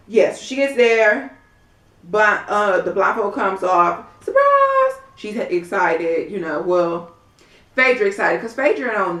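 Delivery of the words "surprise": surprise shout. Retold or imitated. Guests shouting at the party.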